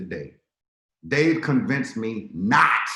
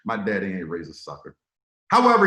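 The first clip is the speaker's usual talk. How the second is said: ordinary talk, nothing marked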